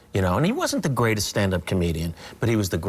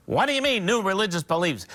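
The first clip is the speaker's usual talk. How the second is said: higher, pompous sportscaster drone; Cosell-style ringside announcer, higher and grand; Howard Cosell; 9 semitones higher than usual. Acted. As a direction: spell out every word like a self-important announcer. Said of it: "the Howard Cosell part".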